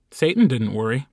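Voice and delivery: plain reading voice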